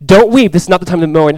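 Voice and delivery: urgent call. Retold or imitated. Leaders urging people to stop crying.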